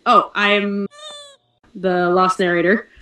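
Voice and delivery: ordinary talk, nothing marked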